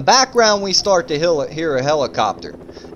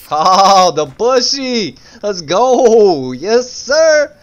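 loud, high cheer with drawn-out words; excited hype shout; 5 semitones higher, 7 dB louder, much slower than usual. Real, not acted. Player cheering on a bushy friend, excited hype shout. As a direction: whoop it up for your teammate. Hypes up teammate's move in game.

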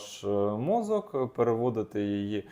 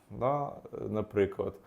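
ordinary delivery

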